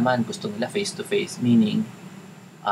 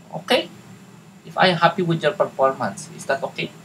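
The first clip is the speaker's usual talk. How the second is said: higher-pitched Tagalog-accented English, "performance" with a p; botched Tagalog accent; 5 semitones higher than usual. Shown as a demonstration. Coach acting out the pay negotiation, exaggerated Tagalog accent. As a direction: play up a clumsy Tagalog accent. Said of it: "ginagawa kong palpak talaga yung Tagalog, yung English accent".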